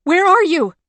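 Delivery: high, loud frantic cry; panicked shouted question; screamed question. A narrator's reading. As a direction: scream it into the empty ice. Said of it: "she screamed".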